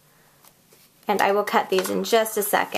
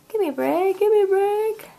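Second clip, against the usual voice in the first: high, drawn-up mock plea; playful pleading; 11 semitones higher than usual. Crafter coaxing her tool mid-project, playful high-pitched pleading. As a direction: beg in a high, put-on little voice.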